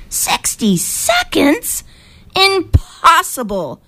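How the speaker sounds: high, slow, drawn-out incredulity; disbelief. A narrator's reading. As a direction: stretch it out high in disbelief, without shouting. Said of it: "Moshe blinked in disbelief".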